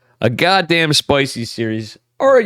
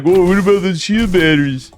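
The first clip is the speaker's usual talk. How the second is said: mildly raised, indignant mock whine; lightly voiced complainer; mock whiny complainer; 5 semitones higher than usual. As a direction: imitate a fan who is annoyed with Protoss. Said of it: "anybody that gives Protoss shit, like".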